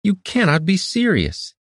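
high, outraged disbelief; incredulous shout. A narrator's reading. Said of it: "Iridan shouted".

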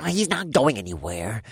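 nasal grumble. Acted. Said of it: "the nasally voice grumbled".

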